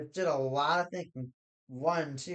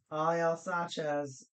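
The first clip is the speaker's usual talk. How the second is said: normal talking voice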